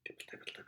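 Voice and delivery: whispered dibbles like raindrops; rain sound. Shown as a demonstration. Showing a child the rain's sound, whispered. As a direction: breathe it out like light rain. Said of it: "He can sound like the rain".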